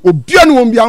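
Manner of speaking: ordinary talk, nothing marked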